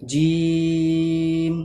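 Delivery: জিম held very long, tongue pressed; long pressed jim. Shown as a demonstration. Teacher modelling the long pressed jim for pupils. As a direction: stretch জিম out for three seconds. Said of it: "আমার সঙ্গে তোমরা বলো".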